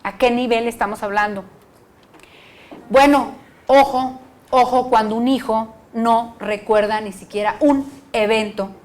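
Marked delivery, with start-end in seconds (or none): 2.9-4.2 "ojo" lento, fuerte y arrastrado; llama la atención con peso; advertencia enfática. Real, not acted. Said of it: "advertencia a terapeutas". (advierte a los terapeutas con énfasis)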